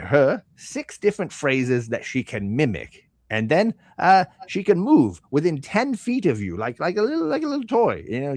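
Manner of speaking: high, lilting sales patter; salesman shopkeeper. Acted. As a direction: pitch a toy like an eager merchant.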